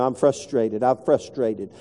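low, soft, worn-out repeated complaint; frustrated. Retold or imitated. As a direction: sound fed up and drained. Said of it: "I kept telling him".

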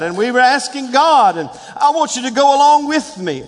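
building from firm insistence to high, full-force preaching; fired-up preaching. Real, not acted. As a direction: drive each declaration harder, pitch climbing to a shouted rally cry.

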